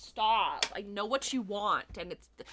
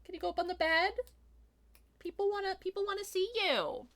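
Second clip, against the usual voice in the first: talking to a pet; 9 semitones higher, 6 dB quieter than usual. Real, not acted. Coaxing her cat onto bed.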